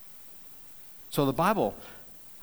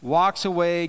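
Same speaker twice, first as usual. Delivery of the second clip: ordinary talk, nothing marked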